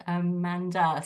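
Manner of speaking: normal talking voice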